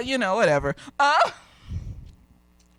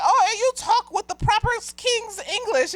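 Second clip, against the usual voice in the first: put-on little boy; 11 semitones higher than usual. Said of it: "student, another little boy".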